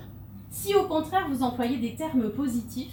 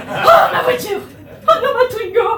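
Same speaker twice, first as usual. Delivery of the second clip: much higher, loud mock panic laughing; panicked husband; 12 semitones higher, 13 dB louder than usual. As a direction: cry out over the car alarm like a panicking husband. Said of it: "il se réveille". (mari paniqué pour sa Twingo, cri moqueur et aigu)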